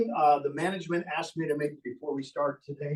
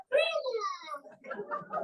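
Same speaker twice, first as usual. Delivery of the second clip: high puppet voice calling; 7 dB quieter than usual. Acted. Puppet calls for grandma.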